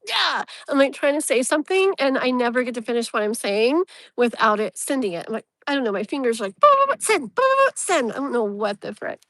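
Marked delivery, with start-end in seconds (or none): 0.1-0.4 mock exasperated yeah. Mock frustration at phone. 6.6-8.1 high, loud, chirpy boop boop send; fingers going boop. Retold or imitated. Imitating fingers hitting send.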